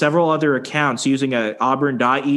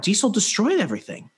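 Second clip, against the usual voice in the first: ordinary delivery, nothing marked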